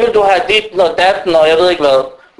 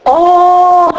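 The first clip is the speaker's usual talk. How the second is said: startled gasp; 10 semitones higher, 4 dB louder than usual. Retold or imitated. Mock startled child.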